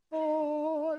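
long, high, held "ohh" of wonder; mock heavenly choir note; sustained high "ohh", as if beholding glory; awestruck "ohh". Mimics cats awed by the bed.

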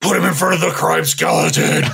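lightly voiced caped crusader; Batman voice. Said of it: "That's my best Batman".

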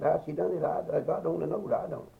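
ordinary delivery

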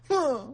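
put-on high, sharp upset-kid huff; short, high huff of refusal; imitated huff. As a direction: huff like a sulking little kid. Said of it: "they'll huff at you when they're upset".

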